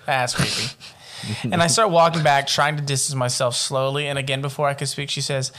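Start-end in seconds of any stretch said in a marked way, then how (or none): none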